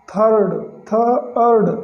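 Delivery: थर्ड drawn out slowly with an "aa" vowel, thaard; slow thard. Shown as a demonstration. Teacher slowly demonstrating थर्ड to learners. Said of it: "आई का आ हो गया".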